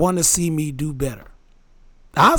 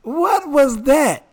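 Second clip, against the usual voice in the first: surprised; 9 semitones higher, much slower than usual. Real, not acted. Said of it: "You talking like Ratatouille".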